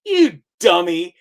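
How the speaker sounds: high, slow incredulous put-on voice; mock scoffing. Mocking the broadcast's confused listener, scoffing. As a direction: scoff at it in a silly voice.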